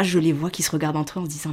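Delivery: ordinary delivery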